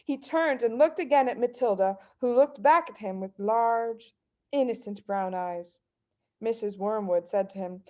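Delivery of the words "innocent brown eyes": ordinary delivery, nothing marked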